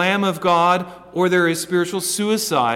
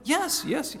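eager high reply; 10 semitones higher, 3 dB quieter than usual. Retold or imitated. Peter answers again.

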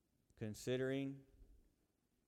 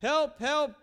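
higher, louder panicked plea; desperate cry for help; 18 dB louder than usual. Acted. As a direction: cry out in terror from the flames. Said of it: "gasps the man".

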